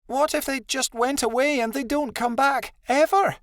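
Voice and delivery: very high anxious whimper. A narrator's reading. Adelaide fears they will never come back, whimpering. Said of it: "Adelaide whimpered".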